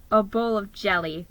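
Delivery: ordinary delivery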